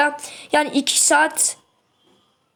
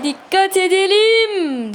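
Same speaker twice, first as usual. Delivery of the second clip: tense warning; 7 semitones higher, 3 dB louder, much slower than usual. Real, not acted. Tense match moment, warning.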